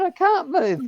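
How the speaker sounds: high, loud comic protest; mock protest. Handcuffed man answers cop, high mock protest. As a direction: protest helplessly, pitched right up.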